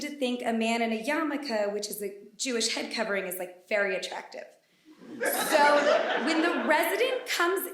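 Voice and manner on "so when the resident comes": 1 laughing. Real, not acted. Telling a story to a live crowd.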